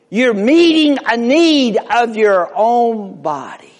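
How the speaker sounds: high, emphatic pulpit delivery; forceful preaching. Real, not acted. Preacher showing listeners how they love themselves, forceful.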